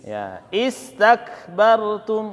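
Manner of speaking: istakbartum drawn out slowly, starting with i; slow model reading. Shown as a demonstration. Teacher shows the students istakbartum read slowly. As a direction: say istakbartum slowly, first vowel i. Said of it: "Jadi bacanya".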